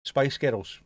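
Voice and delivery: lightly put-on Glaswegian accent. Shown as a demonstration. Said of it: "saying Spice Girls in a Glaswegian".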